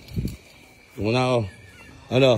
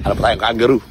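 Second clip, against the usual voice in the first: usual speaking voice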